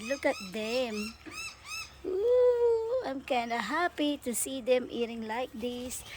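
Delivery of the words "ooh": excited squeal. Real, not acted. Delighted squeal at birds.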